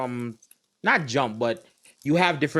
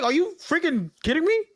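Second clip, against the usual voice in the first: disbelief. Retold or imitated. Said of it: "I was like".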